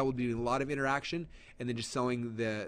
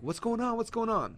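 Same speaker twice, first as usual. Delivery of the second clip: high, panicky mock alarm repeated; freaking out; 9 semitones higher than usual. Eight thousand followers confused by activity, high mock panic. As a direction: play the confused followers, voice jumping up. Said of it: "people are freaking out saying".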